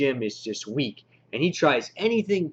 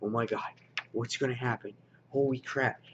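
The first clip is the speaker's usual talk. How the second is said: hushed, softer suspense; edge-of-seat hush; 2 semitones lower, 9 dB quieter than usual. Retold or imitated. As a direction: breathe it out, glued to the page. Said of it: "just like this".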